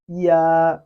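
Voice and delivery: loud, drawn-out ya for students to copy; Chinese ya. Shown as a demonstration. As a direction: model ya clearly and loudly. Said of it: "repeat after me".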